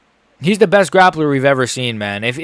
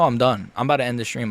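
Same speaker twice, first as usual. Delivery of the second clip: usual speaking voice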